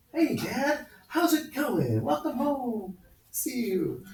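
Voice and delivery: high-pitched sing-out to Dad; put-on chirpy kid voice; innocent kid greeting. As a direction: greet him as a sweet little child hoping to dodge trouble.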